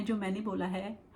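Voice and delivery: ordinary delivery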